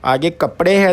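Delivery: usual speaking voice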